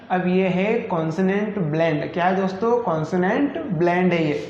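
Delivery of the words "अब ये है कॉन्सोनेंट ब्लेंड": usual speaking voice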